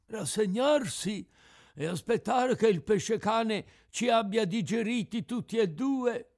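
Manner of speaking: high, loud cracked fish voice; resigned tuna. Acted. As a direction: deliver the grim advice as a cracked-voiced tuna.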